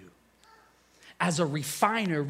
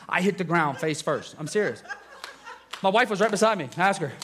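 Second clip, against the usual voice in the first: ordinary talk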